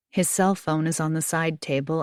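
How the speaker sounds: ordinary delivery, nothing marked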